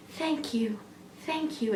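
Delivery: near-whispered thank you from a clingy stranger; hushed mumbled thanks. Retold or imitated. Stranger hugging her, mumbling thanks quietly. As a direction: breathe the thanks out low into her shoulder. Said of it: "he's like".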